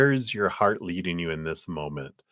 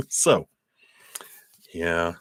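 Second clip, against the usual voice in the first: crunching cough drop; 3 semitones higher, 7 dB louder than usual. Real, not acted. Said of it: "Sorry, I'm crunching on my cough drop here".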